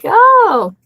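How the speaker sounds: high, loud put-on casual oh; mock casual oh. To the tattooed woman, a high feigned casual oh. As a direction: toss it off as if mildly surprised. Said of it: "brought it up as a casual".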